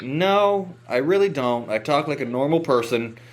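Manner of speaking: high-pitched, incredulous protest; indignant denial. Retold or imitated. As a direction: push back hard, pitched well up. Retold protest against drawl accusation.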